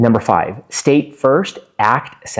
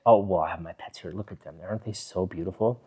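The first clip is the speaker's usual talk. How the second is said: soft, lower voice, cooing over the pets; gently admiring, a touch quicker and much softer; calm, relaxed; 4 semitones lower, 10 dB quieter, faster than usual. Shown as a demonstration. Relaxed vlogger cooing over her pets, softly. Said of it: "you can just sit like this in a video and look".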